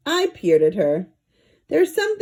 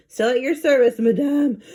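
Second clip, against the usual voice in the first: gasping; 6 semitones higher, 3 dB louder than usual. A narrator's reading. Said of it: "I gasped".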